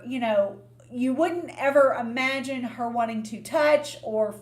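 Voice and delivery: normal talking voice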